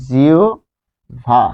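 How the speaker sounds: জিওভা slowly split, "jio... bha"; drawn-out pronunciation. Shown as a demonstration. Teacher shows learners how it will be said, drawn-out pronunciation. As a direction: say জিওভা in two long, separated parts. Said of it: "তাহলে এটি কি হবে?".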